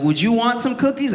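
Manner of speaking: put-on high sweet little girl offering cookies. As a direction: lean in with a high, sugary kid voice. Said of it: "she's like".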